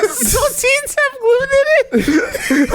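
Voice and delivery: high, slow, laughing question; laughing girlfriend. Retold or imitated. Host imitating the girlfriend's realization, laughing. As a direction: squeak it out while cracking up. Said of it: "the last thing he hears is".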